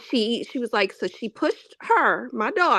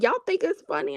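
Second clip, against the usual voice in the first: higher mock mom voice; lightly voiced stern mom; mom scolding; 7 semitones higher than usual. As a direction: mom catches the giggling kids, mock stern. Mom scolds laughing kids.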